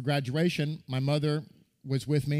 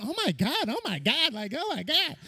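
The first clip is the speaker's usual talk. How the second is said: high, gasping mom; mother aghast at the drill instructor; high horrified oh my God; shocked mother; 11 semitones higher than usual. Retold or imitated. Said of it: "my mother was going".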